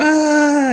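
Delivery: very high, loud yelp of panic; panicked yelp. Retold or imitated. Drummer scrambling for a dropped stick, high yelp. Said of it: "picking up a stick and go".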